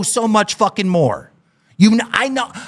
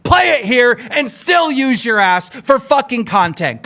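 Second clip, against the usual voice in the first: ordinary delivery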